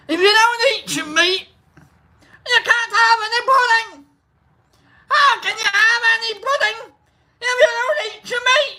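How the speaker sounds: high, hollering Scottish schoolmaster; Scottish accent. Acted. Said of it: "This is where I get my Scot".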